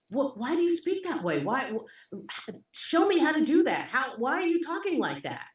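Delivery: high-pitched, amazed questioning; eager, fascinated questions rising high; curious wonder. Retold or imitated. Child asking people about their accents, in curious wonder.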